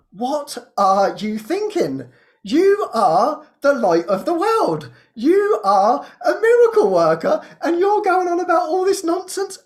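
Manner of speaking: "You are" drawn out slowly, high and loud; emphatic reproach. Retold or imitated. Inner voice jolting the victim, "You are" slow, high and forceful. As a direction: hammer "light of the world" and "miracle worker", pitched high. Acts sudden scolding, uplifting inner voice.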